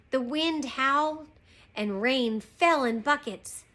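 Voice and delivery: usual speaking voice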